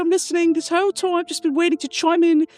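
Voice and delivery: put-on high-pitched, mashed-up British accent; squeaky mix of Brighton and Newcastle accents; muddled British regional accents. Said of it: "How many different regional accents".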